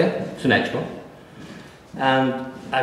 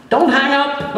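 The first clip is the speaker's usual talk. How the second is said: high, louder urgent plea; pleading editor on phone; 4 dB louder, slower than usual. Retold or imitated. Editor calling back begs author to stay on the line, high and urgent. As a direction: beg him not to hang up.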